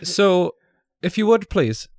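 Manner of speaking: a hint of a prim Swedish guard, overly courteous; Swedish accent. Swedish security guard scolds skater.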